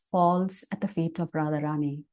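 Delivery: ordinary talk, nothing marked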